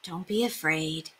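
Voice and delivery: soft, gentle comfort; calm her son; reassuring mother. A narrator's reading. Mother soothing her frightened son, soft. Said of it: "she said".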